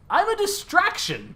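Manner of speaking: slow, high comic delivery; a high, drawn-out goofy line; high silly voice. Acted. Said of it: "he goes".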